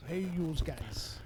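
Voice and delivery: slow drawn-out New York greeting; 1930s beat cop. Shown as a demonstration. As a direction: lean on the yous. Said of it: "she loves to hear me say it like that".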